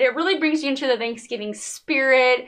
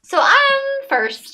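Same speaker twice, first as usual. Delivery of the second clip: excited; 12 semitones higher, 3 dB louder, much slower than usual. Real, not acted. Announcing her turn.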